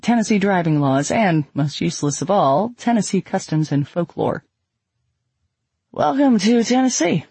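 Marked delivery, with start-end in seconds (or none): 5.9-7.3 serious lecturer. A narrator's reading. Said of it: "said the serious-looking man lecturing".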